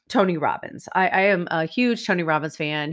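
ordinary delivery

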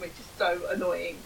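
usual speaking voice

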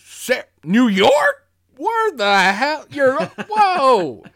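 very high, loud, drawn-out disbelief; hammed-up astonished outburst; Tom Atkins impression. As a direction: stretch each word in mock amazement. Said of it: "inflection is so spot on for him".

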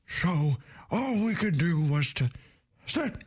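put-on creaky, slow old grandpa. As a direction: talk like a doddering old man. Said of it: "I'll do Grandpa".

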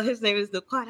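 usual speaking voice

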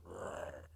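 low, soft throaty growl; quiet imitation of a demon growling; imitated growl. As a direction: growl low like a menacing creature. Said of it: "he growled".

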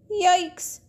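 high, loud yelp; a scared child; startled. Acted. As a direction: jump at the noise. Startled at night.

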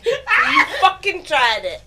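ordinary talk

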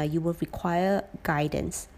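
ordinary talk

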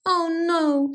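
high, drawn storybook "oh no"; squealed dismay. A narrator's reading. Pig lady finds her sticks gone, squealing. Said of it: "squealed the pig lady".

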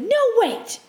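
high-pitched mock scream, no real volume; retold inner scream. Retelling a protest to herself in her head, high mock scream. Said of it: "like, screamed at myself in my head".

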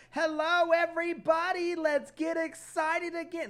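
high, loud mock-excited greeting; hyped host. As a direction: pump up the crowd like an MC. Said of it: "was immediately like".

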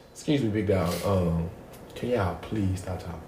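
soft, careful polite ask; polite quiet request. Retold or imitated. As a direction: ask them gently to hush. Politely asking couple to hush.

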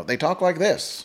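1940s film star Mid-Atlantic accent. Shown as a demonstration. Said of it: "They did the Mid-Atlantic accent".

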